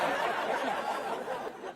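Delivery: airy unvoiced laugh burst; breathy laugh. Real, not acted. Comic telling a claustrophobia story, breaking into a wheezing laugh. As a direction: let out a silent wheezing laugh.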